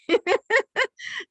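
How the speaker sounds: high burst of laughter at a pun; real giggle. Real, not acted. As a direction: laugh at your own pun. Laughs after pun joke.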